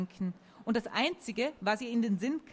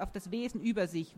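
ordinary delivery, nothing marked

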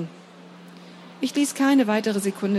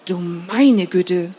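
slow, louder shocked exclamation; horrified gasp; 6 dB louder, much slower than usual. Acted. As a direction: blurt it out in horror. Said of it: "entfuhr es Dominik voller Entsetzen".

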